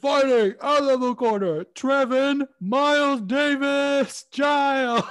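high, loud, stretched-out fight introduction; mock ring announcer. Announcing a fighter with a new name, mock ring announcer.

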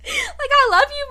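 high, loud laughing protest; half-crying laughter. Real, not acted. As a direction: say it while cracking up.

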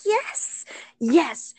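high, soft squeal dropping down; thrilled but keeping it small; eager yes. Retold or imitated. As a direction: act out a giddy, hushed acceptance. Acts out eager answer to kiss request.

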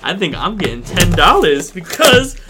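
high, loud, building to triumph; excited reveal. Real, not acted. Podcaster reveals he won the ten dollar bet, excited. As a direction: build up and crow about the win.

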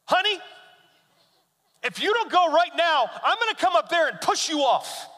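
high, loud teasing threat; mock threat. Dad jokingly threatening his daughter, high and loud. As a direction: playfully warn her.

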